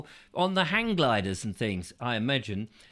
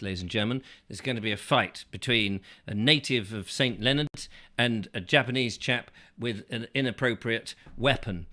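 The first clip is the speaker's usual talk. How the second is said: ordinary delivery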